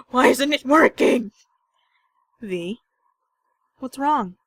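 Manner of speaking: high, loud panic; frantic that reload isn't working; panicked. Real, not acted. Player panicking as the game's reload fails, loud and high.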